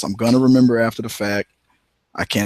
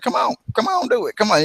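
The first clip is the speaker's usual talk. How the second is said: daring; 11 semitones higher than usual. Retold or imitated. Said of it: "I'll be like".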